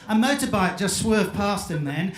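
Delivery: normal talking voice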